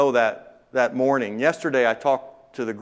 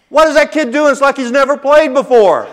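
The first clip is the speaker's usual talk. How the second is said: much higher, louder coach's yell in disbelief; 14 semitones higher, 11 dB louder than usual. Retold or imitated. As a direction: shout it like a baffled visiting coach. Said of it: "The visiting coach yelled out".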